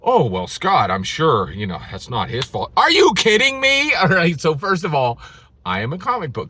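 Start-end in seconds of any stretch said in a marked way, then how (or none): 0.0-2.7 low, mocking voice of a naysayer; lower, dismissive imitation of a doubter; mock excuse. 2.8-3.9 higher and louder, disbelieving; exasperated disbelief, a bit louder; incredulous. Real, not acted. Incredulous rant outburst.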